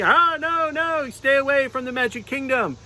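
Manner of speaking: high-pitched unicorn pretending to fight; little unicorn defending her kingdom, up high; unicorn puppet voice. Unicorn puppet fending off the monster, high voice. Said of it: "[name] was fighting me".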